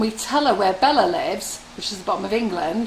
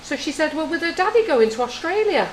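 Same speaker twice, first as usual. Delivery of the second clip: put-on high childish quote; 8 semitones higher than usual. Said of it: "she goes".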